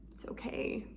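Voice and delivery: whispered reassurance; soothing whisper. Shown as a demonstration. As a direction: breathe it out softly. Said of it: "comforting her".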